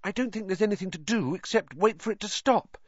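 put-on high-pitched worried girl; anxious Hermione, pitched well up. A narrator's reading. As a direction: fret aloud in a high voice. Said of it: "said Hermione anxiously".

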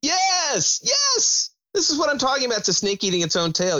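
high, eager yes; excited. Real, not acted. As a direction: burst in with excited agreement. Excited agreement, voice jumps high.

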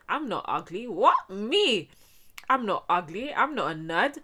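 high, loud mock disbelief; indignant self-defence. Girl vowing to prove she's not ugly, indignant. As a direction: protest it, voice leaping up on the questions. Acts out defiant vow to herself.

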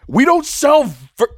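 high, loud indignant outburst; loud high-pitched protest; indignant yell. Acted. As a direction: yell it across the bar, cut off. Acted indignant lion at customer.

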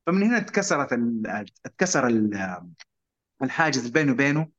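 ordinary talk, nothing marked

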